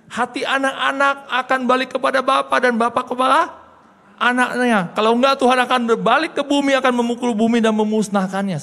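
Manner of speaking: high, loud preacher's cry; preaching at full force. Acted. Quoting Joel, preaching. (khotbah berapi-api dengan suara keras dan tinggi)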